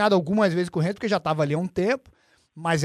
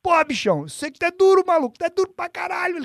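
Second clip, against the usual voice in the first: higher, lively Renzo impression; Renzo's way of talking; 10 semitones higher than usual. The coach Renzo cheering up his crying student, high and lively. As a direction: do Renzo's buddy-like way of talking, pitched up. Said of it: "aquele jeito do Renzo".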